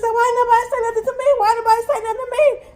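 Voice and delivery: indignant disbelief. Retold or imitated. Principal shocked nobody told her.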